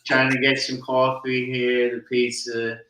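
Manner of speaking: a hint of New Jersey Italian accent; put-on Jersey accent. Host asks for his Jersey accent, lightly put-on Jersey accent. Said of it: "Yeah, I'm putting it on".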